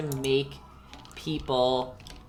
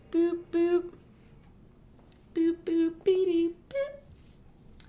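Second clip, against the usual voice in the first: high cartoon boops, far above her voice; squeaky cartoon boop noises; Betty Boop sound; 13 semitones higher, much slower than usual. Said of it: "my Betty Boop sound".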